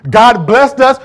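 triumphant preaching shout. Acted. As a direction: belt it out to the congregation.

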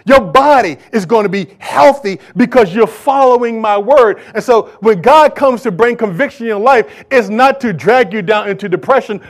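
normal talking voice

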